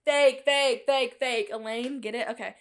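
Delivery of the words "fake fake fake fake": mock accusing shout. Teasing viewers about the fake-out, mock shouting. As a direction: call it out like busting a fraud. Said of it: "I fooled you guys".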